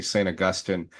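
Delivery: "Augustine" said uh-GUS-tin after Saint; preferred pronunciation. Shown as a demonstration. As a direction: stress GUS in "Augustine", short ending. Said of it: "it just sounds right to me".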